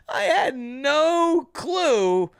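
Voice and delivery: ordinary talk, nothing marked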